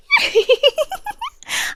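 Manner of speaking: a high, coy little laugh at her own slip; mock innocent giggle. Queen covering her slip to her captive, coy high giggle. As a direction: giggle as if caught out.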